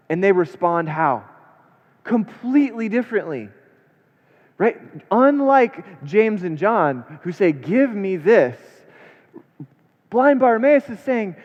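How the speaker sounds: high, loud, emphatic preaching; fervent preacher's climax; preaching at full force. Acted. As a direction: drive the point home to the congregation.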